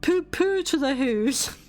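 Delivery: ordinary delivery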